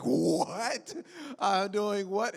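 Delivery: acted-out disbelief, pitch jumping way up; incredulous reaction. Actor retelling a reaction to a new role, incredulous. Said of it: "and I'm like".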